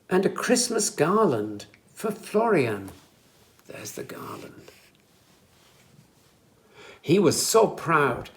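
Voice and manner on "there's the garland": hushed aside; whisper. Shown as a demonstration. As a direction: breathe it out softly. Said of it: "unvoiced aside".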